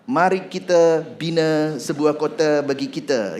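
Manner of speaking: put-on Malay-accented Bible reading, vowels softened to schwa. Preacher reads a Borneo Bible to the congregation, Malay accent. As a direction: read in a playful Malay accent. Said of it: "ini ke mele-melean gitu ya".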